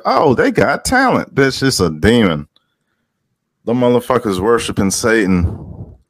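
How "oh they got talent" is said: high, singsong mock gushing; mock admiration. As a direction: imitate impressed fans, pitched right up. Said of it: "people be like".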